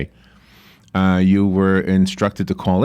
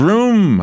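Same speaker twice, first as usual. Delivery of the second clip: louder, higher engine vroom; race car zooming past; 9 semitones higher, 4 dB louder than usual. Shown as a demonstration. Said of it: "get [name] to do it".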